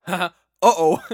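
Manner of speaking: loud high laughing uh-oh. Retold or imitated. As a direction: laugh it out, then a mock uh-oh. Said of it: "all of a sudden she's like".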